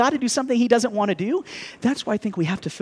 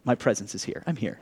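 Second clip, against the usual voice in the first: tender reassurance; 9 semitones lower, 7 dB quieter than usual. Retold or imitated. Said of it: "to let her know it's like".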